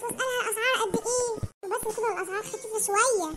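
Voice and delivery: normal talking voice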